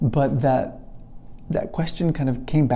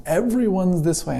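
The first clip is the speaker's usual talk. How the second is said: higher-pitched mock insistence; contentious; 6 semitones higher than usual. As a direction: insist like an arguing professor. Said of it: "a little contentious at times".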